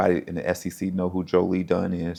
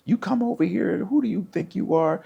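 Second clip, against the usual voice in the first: high-pitched mock scolding from a coach; coach's scolding; 11 semitones higher than usual. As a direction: imitate an indignant coach calling you out. Coach scolding him, retold.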